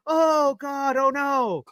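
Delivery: mock gasp. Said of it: "So when someone died".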